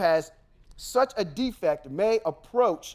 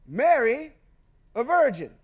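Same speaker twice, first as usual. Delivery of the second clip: mocking challenge; 7 semitones higher, 3 dB louder than usual. Real, not acted. Challenging the listener's messiah, mockingly.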